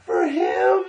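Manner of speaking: high, loud, slow desperate announcer yell; frantic host hype shout. Retold or imitated. Host who forgot the name, shouting a desperate hype. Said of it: "He goes".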